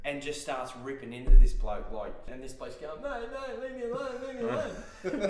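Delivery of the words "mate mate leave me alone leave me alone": high, hammed-up begging; mock pleading. Bloke being grabbed begs the cowboy, high mock pleading. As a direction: whine it like a cornered bloke.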